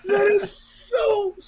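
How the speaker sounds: high shrieking Oh my God; retelling a skit, shouting in mock horror; mock horrified shout. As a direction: shriek it in hammed-up shock. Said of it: "he's like".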